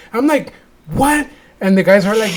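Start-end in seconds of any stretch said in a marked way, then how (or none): 0.9-1.3 high, questioning mock shock; retold disbelief. Reacting to the faith ceremony, high incredulous voice. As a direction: pitch it up in baffled surprise.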